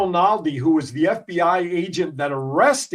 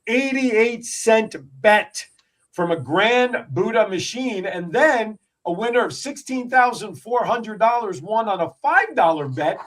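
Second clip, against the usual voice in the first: higher-pitched, punchy story read; news read; 5 semitones higher than usual. Acted. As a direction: read the jackpot news out like a radio host. Reads a news item aloud.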